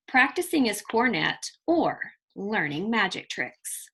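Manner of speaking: put-on lively read-aloud storytelling for children; expressive children's story reading; story narration. A narrator's reading. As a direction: read it like a picture book to a class. Teacher reads picture book aloud.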